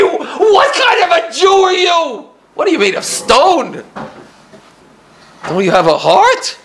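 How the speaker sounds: high, loud, slow accusing shout; loud, high, drawn-out reproach; screaming. Retold or imitated. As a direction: yell it at him in outrage. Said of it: "starts screaming at me".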